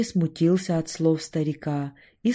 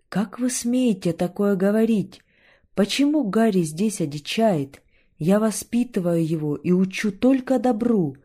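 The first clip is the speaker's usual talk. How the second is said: loud, high angry retort; angry outburst; 5 semitones higher, 6 dB louder than usual. A narrator's reading. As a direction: flare up at the visitor. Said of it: "то теперь вспылил".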